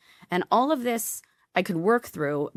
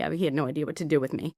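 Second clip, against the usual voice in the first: normal talking voice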